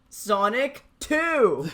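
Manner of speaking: slow hyped title call; announcer voice. Pretend. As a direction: announce it like a game trailer.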